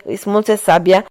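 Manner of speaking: usual speaking voice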